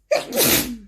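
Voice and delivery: dry real cough. Real, not acted. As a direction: cough into the mic. Reader coughing during reading.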